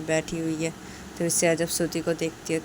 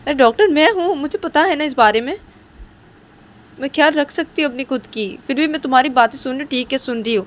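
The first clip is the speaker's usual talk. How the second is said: raised, loud scolding; annoyed yelling; 4 semitones higher, 7 dB louder than usual. Acted. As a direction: tell someone off in exasperation. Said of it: "ऐसे चिल्लाओ मत ना". (झुंझलाकर ऊँची आवाज़ में डाँटना)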